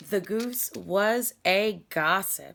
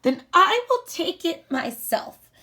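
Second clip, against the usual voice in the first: the little hen deciding to do it alone; determined hen; 10 semitones higher, 8 dB louder than usual. Acted. Hen answers the refusal.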